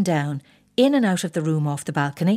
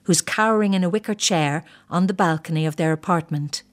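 ordinary delivery, nothing marked

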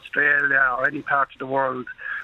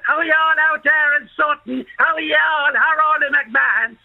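higher, louder Dublin greeting; lightly voiced Dublin cousin greeting; Dublin accent; 9 semitones higher, 4 dB louder than usual. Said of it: "cousins in Dublin".

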